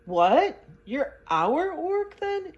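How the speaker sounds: high, loud, slow astonished question; a little girl's amazed cry; amazed demand. A narrator's reading. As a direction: demand it in disbelief. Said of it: "demanded the little girl, much amazed".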